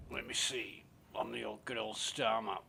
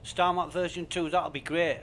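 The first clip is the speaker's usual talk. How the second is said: mocking; 7 semitones higher, 7 dB louder than usual. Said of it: "was saying".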